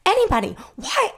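high-pitched, loud pleading; all worked up. Retold or imitated. Girl flipping out at classmates, all worked up. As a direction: wail it in dramatic exasperation. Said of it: "getting all worked up".

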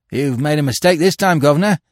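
loud, high, fierce protest; fierce cry. A narrator's reading. Pickpocket protests to the arresting officer, fierce. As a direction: cry out defiantly at the accuser. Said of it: "cried Bill fiercely".

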